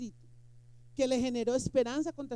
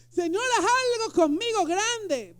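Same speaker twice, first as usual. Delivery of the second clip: mock pleading prayer; 8 semitones higher, 7 dB louder, slower than usual. Imita oración pidiendo.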